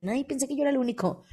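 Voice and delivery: soft, quick relieved admission; soft confession. Retold or imitated. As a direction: let it slip out quietly and fast. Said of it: "saludos a los que dicen".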